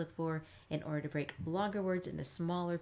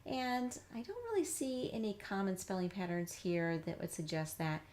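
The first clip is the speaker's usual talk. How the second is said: ordinary talk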